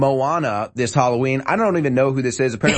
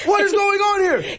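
high hammed-up bewildered shout; mock shock. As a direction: cry out in feigned astonishment. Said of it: "hear the shock in his voice?".

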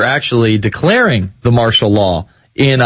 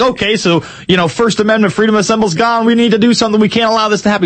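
much higher, urgent mock alarm; 8 semitones higher, faster than usual. Cohost imagines anchor freaking out about rights, high and urgent. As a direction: sound the alarm in a mock panic. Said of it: "freaking out".

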